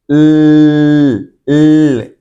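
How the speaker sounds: "l" held long and loud as a humming sound; voiced consonant. Shown as a demonstration. Teacher has students feel the vibration, "l" sustained with full voice. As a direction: hold "l" so the throat vibrates. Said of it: "que tiene sonido".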